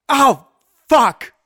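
high, loud frightened curse; panicked curse. Acted. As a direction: yelp it in panic. Antler scrapes his back.

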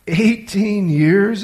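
can't believe what he's just heard; astonished exclamation. Acted. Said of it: "exclaimed Malcolm".

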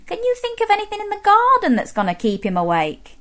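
ordinary delivery, nothing marked

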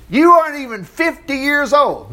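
high, scoffing objection; incredulous scoff. Acted. Leaders scoff at Jesus's claim.

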